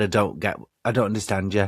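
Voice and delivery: low-pitched put-on Irish accent; thick Irish accent. Friend pretending not to understand, in a low Irish accent. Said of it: "your Irish accent on really thick".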